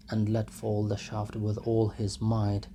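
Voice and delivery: ordinary delivery, nothing marked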